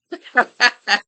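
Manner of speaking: short high burst of laughter; real laugh, pitched high. Real, not acted. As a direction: laugh at the crude quote. Laughs at the insult quote.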